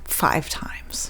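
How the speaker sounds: whisper. Real, not acted. Deadpan joke answer.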